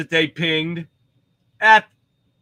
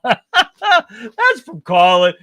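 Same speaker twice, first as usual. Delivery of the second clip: laughing; 6 semitones higher, 4 dB louder, much slower than usual. Real, not acted. Reading a funny viewer comment.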